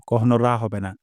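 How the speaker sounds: ordinary talk, nothing marked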